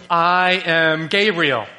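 slow, announcing I am Gabriel; slow, mock-majestic angel; grand angel voice. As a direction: proclaim it like a towering messenger. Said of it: "the angel says something amazing".

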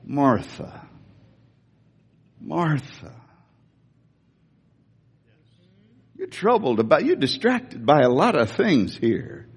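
a patient teacher calming a busy woman; gentle Jesus. Shown as a demonstration. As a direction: call her name gently, twice, unhurried. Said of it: "I sense that it was more like".

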